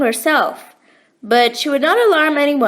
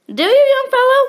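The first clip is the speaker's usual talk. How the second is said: amused warm; 11 semitones higher, 4 dB louder than usual. A narrator's reading. Kind man amused by boy.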